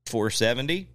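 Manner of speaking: ordinary delivery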